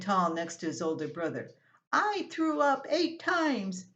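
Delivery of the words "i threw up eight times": put-on higher, slow boyish boast; lightly voiced kid bragging, drawn out; boastful little boy. A narrator's reading. Younger brother brags to mom he was sickest, higher and slow.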